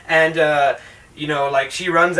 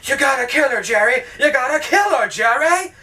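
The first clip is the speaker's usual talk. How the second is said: high, pushy, urgent command; insistent radio; 8 semitones higher than usual. Retold or imitated. The radio urging Jerry to kill, urgent and high. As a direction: egg him on to kill. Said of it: "the radio's like".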